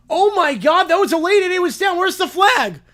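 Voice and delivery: loud, high outraged cry at the late hit; shocked yell. Acted. Fan shouting at the refs over a late hit, outraged yell. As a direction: yell it like a fan who just saw a cheap shot.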